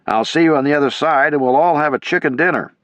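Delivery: loud, high rallying call. A narrator's reading. Major calling out to the troops before the crossing. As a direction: cheer them on across the gap. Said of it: "Major Devers called out".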